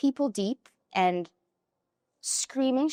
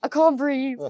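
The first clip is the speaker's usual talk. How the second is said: loud, higher breathless-crying imitation; raised, hammed-up tearful protest; mock sobbing; 5 semitones higher, 7 dB louder than usual. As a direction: act out crying too hard to breathe. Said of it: "Could not stop crying".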